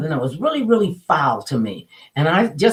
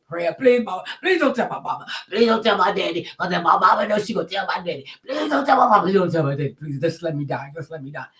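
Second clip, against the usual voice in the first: put-on pleading child; 2 semitones higher than usual. Child caught by an adult, begging her not to tell the parents. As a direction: beg like a caught kid.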